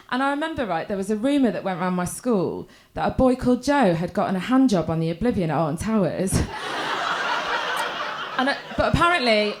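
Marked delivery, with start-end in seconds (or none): 6.4-8.3 silent breathy laugh. Real, not acted. Laughter after rumour.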